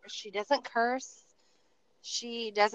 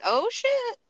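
high, shocked "Oh, shit." with the voice jumping up; stunned exclamation; 12 semitones higher than usual. Retold or imitated. Hairdresser hears client's divorce news, high shocked outcry. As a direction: let it burst out in disbelief, pitched high. Retelling her shocked reaction.

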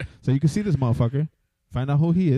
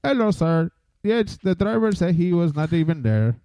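slow, higher mock Indian call-centre agent; mock call-centre accent; 7 semitones higher, slower than usual. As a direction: play the Indian agent politely stonewalling. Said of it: "then they come back".

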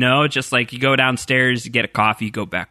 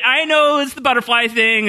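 normal talking voice

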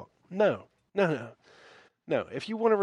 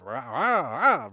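mock rabble-rousing; 2 semitones lower, 3 dB louder, slower than usual. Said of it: "rile the people up".

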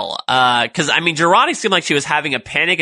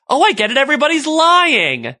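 mock sudden outburst, pitched way up; Elnor; 12 semitones higher, 3 dB louder than usual. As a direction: blurt it out high, imitating a guileless realization. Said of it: "him bursting out in the".

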